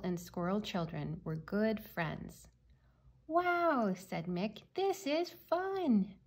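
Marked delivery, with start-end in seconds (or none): 3.3-3.9 high-pitched wow of delight; delighted child. A narrator's reading. Child delighted by playing at night. 4.7-6.1 high, stretched-out delight; delighted child. A narrator's reading. Child delighted by playing at night.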